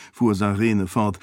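ordinary delivery

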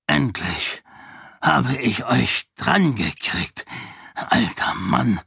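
put-on a fat count gloating over the old duke. A narrator's reading. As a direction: savor each word of the triumph. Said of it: "meinte der Graf schadenfroh".

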